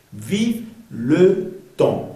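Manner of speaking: "Vive le temps" very slow, word by word; drawn-out French model of "Vive le temps"; nasal temps. Shown as a demonstration. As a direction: let "temps" finish in a nasal vowel. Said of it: "Sonido nasal".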